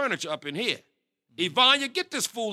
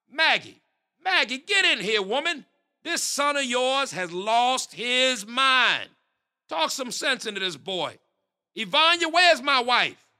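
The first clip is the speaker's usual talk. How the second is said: louder, higher call for his wife; 4 semitones higher, 3 dB louder than usual. Acted. Father shouting for his wife to come help, loud call. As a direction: holler through the house.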